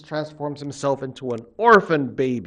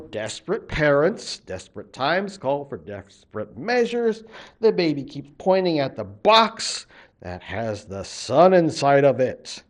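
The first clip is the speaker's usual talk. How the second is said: ordinary delivery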